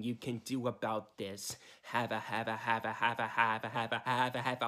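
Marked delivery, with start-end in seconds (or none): 1.8-4.7 deep, stuttering machine laugh loop; lower, glitchy repeated laugh; robotic looped laugh. Acted.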